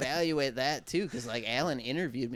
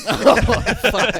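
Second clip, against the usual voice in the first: bursting out laughing, much higher and louder; laughing outburst; 10 semitones higher, 13 dB louder than usual. Real, not acted. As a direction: blurt it mid-laugh, caught off guard. Reacts to "phase three" joke.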